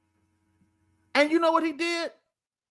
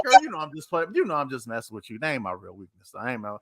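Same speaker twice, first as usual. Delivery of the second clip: much lower, deep laid-back teasing; Samson's voice; 11 semitones lower, 3 dB quieter, faster than usual. Retold or imitated. Samson brushing off Delilah, deep lazy tease. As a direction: drop way down and brush her off lazily.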